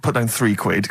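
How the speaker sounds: ordinary talk, nothing marked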